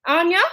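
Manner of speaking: Anya with a long "ah", rising as a question; questioning "AHN-ya?"; checking the pronunciation. Shown as a demonstration. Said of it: "Which one?".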